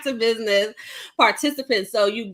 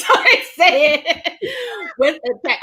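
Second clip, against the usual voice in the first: higher, slower, laughing Bronx drawl; Bronx delivery; 7 semitones higher than usual. Shown as a demonstration. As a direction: lean into the Bronx swagger, laughing. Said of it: "how I exactly said it was".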